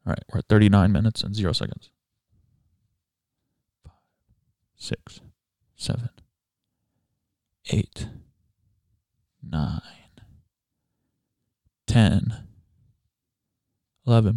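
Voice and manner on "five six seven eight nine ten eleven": quiet counting. Real, not acted. Quietly counting a breath hold.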